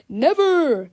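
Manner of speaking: loud defiant hero cry; heroic refusal. Acted. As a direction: belt it out bravely. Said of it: "said Captain Underpants".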